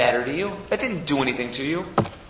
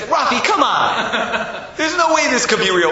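loud dismissal; 10 semitones higher, 5 dB louder, slower than usual. Retold or imitated. Dad dismisses the win, acted.

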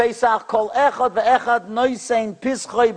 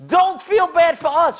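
angry rebuke; 10 semitones higher than usual. Retold or imitated. Dispatcher scolding the apologetic caller, raised voice. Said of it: "got so angry".

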